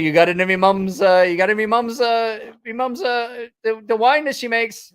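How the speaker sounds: high, loud, stumbling British imitation; botched British accent. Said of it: "can't do a British".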